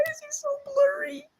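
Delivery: very high, squeaky question through laughter; laughing so hard the voice shoots up; helpless giggling. Real, not acted. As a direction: wheeze it out, too amused to finish. Laughing at blurry picture.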